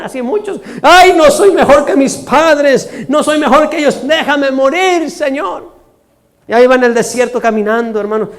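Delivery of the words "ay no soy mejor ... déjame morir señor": high, drawn-out mock lament; Elías weeping. Prophet begs the Lord to let him die, mock crying. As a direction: wail it in hammed-up despair. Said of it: "Todavía lloraba este varón".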